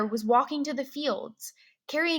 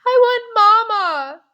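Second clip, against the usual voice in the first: high, loud wail for Mama; tearful loud plea; 10 semitones higher, 12 dB louder than usual. Acted. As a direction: cry out like a scared little boy. Child cries out while hiding.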